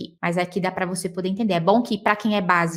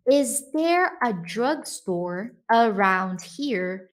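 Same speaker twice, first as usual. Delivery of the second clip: slow, deliberate English question with "around" drawn out; slow tourist's question; slow model sentence; much slower than usual. Shown as a demonstration. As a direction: spell it out slowly for learners. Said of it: "você quer perguntar assim".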